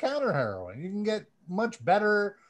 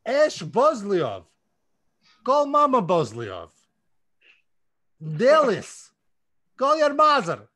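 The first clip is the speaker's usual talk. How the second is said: loud, higher hammed-up Russian accent; 4 semitones higher, 4 dB louder than usual. Reading out fan shoutouts on the podcast in a hammed-up Russian accent. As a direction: ham up a thick Russian accent. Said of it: "I'm gonna do it with a Russian accent for you".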